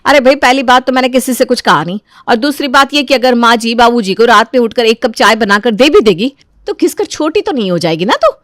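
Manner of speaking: mildly snappish sister-in-law; irritated elder daughter-in-law. Acted. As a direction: warn her off, then defend yourself.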